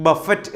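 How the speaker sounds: ബഫറ്റ് with "buh" and a sounded t; common misreading. Shown as a demonstration. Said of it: "ചില ആൾക്കാർ ഇത് വായിക്കുന്നത്".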